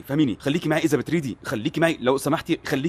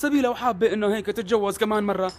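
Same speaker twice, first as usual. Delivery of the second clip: defensive protest; 9 semitones higher than usual. Acted. Defensive protest, high pitched.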